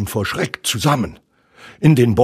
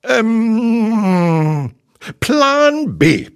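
high, drawn-out hesitation, then decision; high cartoon-tiger improvising; Tigger; 12 semitones higher, 5 dB louder, much slower than usual. A narrator's reading. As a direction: stall on a long hum, then announce the fallback. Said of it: "meinte Tigger".

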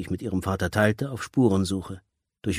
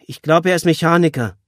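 higher, louder call from the next room; calling back; 9 semitones higher, 8 dB louder than usual. Acted. Daughter calling back to father from kitchen, loud. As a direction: call it back across the flat. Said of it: "rief Julia zurück".

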